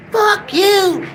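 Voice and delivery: shrill high parrot squawk, way up in pitch; squawking parrot swearing at its owner. Retold or imitated. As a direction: screech it like a cursing parrot. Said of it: "That's all he kept on saying".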